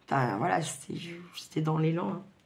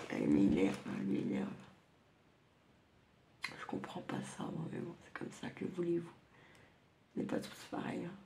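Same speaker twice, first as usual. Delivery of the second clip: muttered annoyance; 8 dB quieter, faster than usual. Real, not acted. Irritated aside about sister, muttered.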